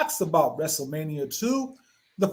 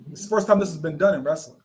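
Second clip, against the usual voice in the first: ordinary delivery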